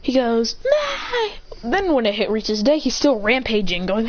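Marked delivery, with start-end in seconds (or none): 0.6-1.3 long, high held wail; high-pitched wail. Acted. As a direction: wail high without words. Acts out the Saiyan's cry.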